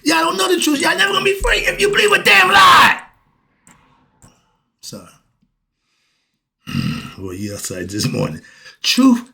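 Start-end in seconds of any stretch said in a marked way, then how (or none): 0.0-3.0 furious preaching shout. Real, not acted. Preacher yells at believers that they are not free. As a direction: rage at them that they believe a lie. Preaching outburst, then apologises.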